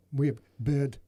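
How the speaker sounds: badly attempted Whiplash accent from Iron Man three. Host clowning about a pet bird, bad Whiplash accent. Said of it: "that's one accent I'm terrible at".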